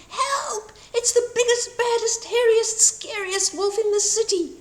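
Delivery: high, loud panicked cries; high frightened shout of old people fleeing. A narrator's reading. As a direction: shout it as you run away.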